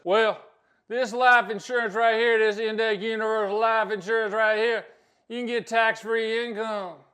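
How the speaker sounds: put-on hammy Southern accent at full volume; Foghorn Leghorn. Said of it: "like a foghorn leghorn thrown in with the James Carville".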